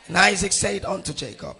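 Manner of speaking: emphatic, measured Bible reading; scripture reading. Acted. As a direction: proclaim the verse phrase by phrase. Reads scripture aloud to congregation.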